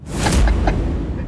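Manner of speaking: breathy villain chuckle; evil laugh. Acted. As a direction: laugh at her cruelly.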